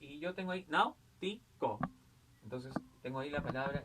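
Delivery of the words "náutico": "náutico" split up, with force on NAU; stress on first syllable. Shown as a demonstration. Teacher showing pupils the stressed syllable, stress on NAU in "náutico". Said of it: "la mayor fuerza de voz es nau".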